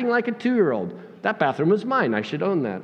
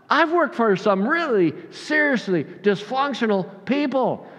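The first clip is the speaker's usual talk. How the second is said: ordinary delivery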